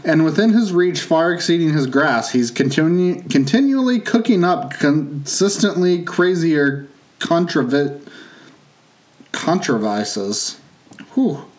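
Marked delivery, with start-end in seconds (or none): 2.5-10.6 slow. Acted. Stumbling over the tongue-twister.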